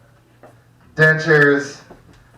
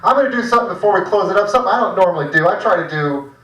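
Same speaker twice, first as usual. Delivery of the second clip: ordinary delivery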